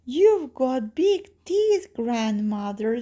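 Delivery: put-on high, loud, slow little girl. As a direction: draw it out high in childish amazement.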